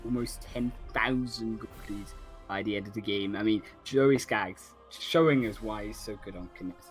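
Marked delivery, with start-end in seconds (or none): none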